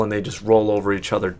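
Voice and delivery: ordinary delivery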